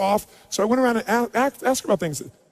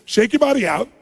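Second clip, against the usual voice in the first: "Shake your body out" said louder and higher, pumped up; energized command; 5 semitones higher, 4 dB louder than usual. Shown as a demonstration. Coach firing up seminar crowd, loud energized command. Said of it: "here's what we're gonna do".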